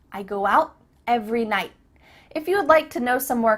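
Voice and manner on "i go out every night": "out" and "night" end unreleased; casual cut-off endings; stopped T. Shown as a demonstration. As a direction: swallow the t in "out" and "night". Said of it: "it's just stopped".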